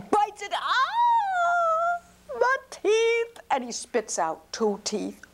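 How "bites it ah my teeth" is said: much higher, louder yelp of pain; pained cry. Acted. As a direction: cry out as the teeth crack. Giant breaks teeth on griddle.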